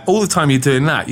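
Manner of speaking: normal talking voice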